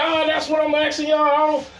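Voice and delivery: imitated high-pitched, exasperated complaint; exasperated customer. Acted quarrel with annoyed customer.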